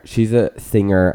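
ordinary talk, nothing marked

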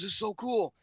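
a hint of a child telling her story; daughter retelling. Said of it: "And she goes".

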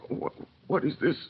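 startled. Acted. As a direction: jolt up in alarm at a face from the past. Manette startled by Lucy's face.

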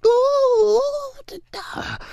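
high mock-scared "ooh", then faint stutter; scared demon noises. Demon scared up in there, high imitated whimper. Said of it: "You got that demon scared".

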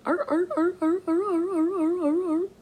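high yelping imitation of coon dogs; howling hound-dog impression; imitating dogs baying.

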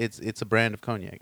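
whispering. Real, not acted. Aside correction.

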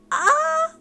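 high, loud startled squeak. Retold or imitated. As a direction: squeak in shock.

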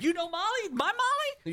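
very high, incredulous squeal; astonished parent. Retold or imitated. Parent to stranger who knows Molly, high mock astonishment. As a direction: imitate a naive parent's amazed delight. Retold naive parents' delighted surprise.

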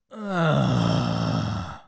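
loud, drawn-out groan of disgust; a plane-wide loud groan of disgust; collective groan of disgust. Retold or imitated. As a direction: groan like a whole plane fed up. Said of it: "a big groan of disgust".

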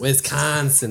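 put-on a light Wisconsin accent on Wisconsin; a hint of the Wisconsin local twang. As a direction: copy the local Wisconsin vowel. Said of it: "That's how they say it".

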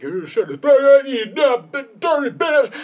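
high, loud mock yell; furious shout. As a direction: scream it like a raging bully. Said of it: "Scott is like".

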